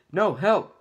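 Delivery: loud, high shout for help; panicked cry for help. Acted. Donkey cornered by ogre Fiona, shouting high and loud for help. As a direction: yell for rescue in a panic.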